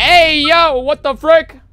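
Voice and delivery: loud, high-pitched disbelieving yell; high, loud comic outrage; shocked outcry. Real, not acted. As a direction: holler it in mock scandal. Shocked at a chat message.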